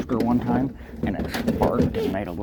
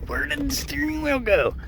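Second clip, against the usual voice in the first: high mock viewer voice; 14 semitones higher than usual. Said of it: "they were all like".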